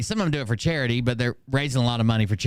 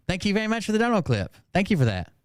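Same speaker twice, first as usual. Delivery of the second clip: excited thanks; 7 semitones higher, faster than usual. Real, not acted.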